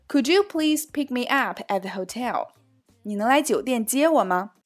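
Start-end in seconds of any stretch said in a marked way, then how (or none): none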